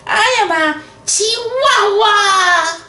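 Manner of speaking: high, slow put-on Spanish accent. A narrator's reading. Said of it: "using his very best Spanish accent".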